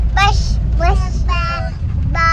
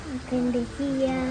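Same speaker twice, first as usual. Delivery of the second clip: a fast, hushed repeat, much softer; soft echo; 3 semitones lower, 14 dB quieter, much faster than usual. Real, not acted. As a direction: copy mum's words under your breath. Child softly echoes mother.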